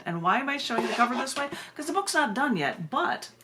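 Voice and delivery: quick, high and bright about the book cover; excited. Real, not acted. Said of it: "I can be excited".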